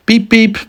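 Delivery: high-pitched short horn toots; two squeaky beeps; car horn beeps. Acted. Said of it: "and went".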